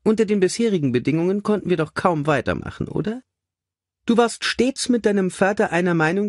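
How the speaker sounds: high, chirpy lady's voice; brisk cheerful lady. A narrator's reading. Mother showing her son the figures, brightly high. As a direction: hand it over brightly, lilting high.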